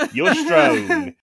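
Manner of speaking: high, rising quote through laughter; laughing. Retold or imitated. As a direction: laugh it out, pitch climbing. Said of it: "I've written down".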